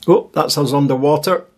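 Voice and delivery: ordinary delivery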